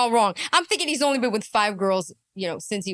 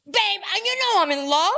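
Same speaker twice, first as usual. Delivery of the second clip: high, overwrought mock pleading; hammed-up lovesick meltdown; jealous boyfriend; 12 semitones higher, 3 dB louder than usual. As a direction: whine and plead, climbing into a loud accusation. Plays jealous, emotional muscle man boyfriend.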